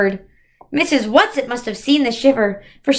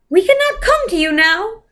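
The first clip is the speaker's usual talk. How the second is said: higher, airy voice from afar; windy distant voice; 8 semitones higher than usual. A narrator's reading. Mrs Who calls down to the children, high and windborne. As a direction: sound like it's carried on the wind. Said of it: "voice blew up to them like the wind".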